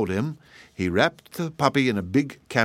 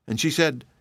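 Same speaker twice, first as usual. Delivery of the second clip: ordinary delivery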